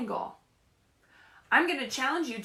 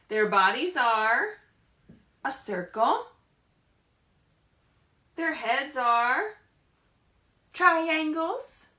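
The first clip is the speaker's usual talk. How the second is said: lively, sing-song picture-book reading; animated storybook voice with questions to the kids; reading to children. Acted. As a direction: read it like story time for little ones. Teacher reads picture book aloud.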